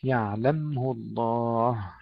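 "يعلمه" slow with a still meem; tajweed demo. Shown as a demonstration. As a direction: draw out "يعلمه الله", meem unbounced. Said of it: "سكون الميم".

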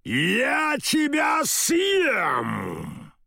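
high, slow roaring threat. Acted. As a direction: roar it to scare her off. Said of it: "проревел".

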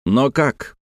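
ordinary delivery, nothing marked

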